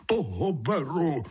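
put-on high, elated old sprite; joyful storybook cry. A narrator's reading. Old water sprite choosing a newcomer, crying out gladly. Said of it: "a zakřičel".

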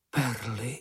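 plain reading voice

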